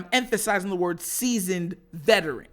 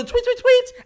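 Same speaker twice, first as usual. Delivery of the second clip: playful high tweet; 7 dB louder than usual. Real, not acted. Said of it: "playful tweet sound".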